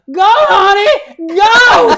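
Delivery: loud, high, drawn-out cheer; imitated woman hollering encouragement; urging yell. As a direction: yell after a runner to keep going. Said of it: "she just yells".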